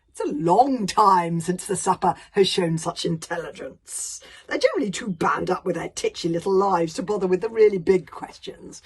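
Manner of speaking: a huge dragon savouring its own wisdom; grand dragon voice. Acted. Dragon lecturing his supper, loud and grand.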